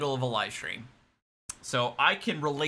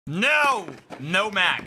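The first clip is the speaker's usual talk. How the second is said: frustrated outburst; 5 semitones higher, 4 dB louder, much slower than usual. Real, not acted. Streamer reacts to PC crash.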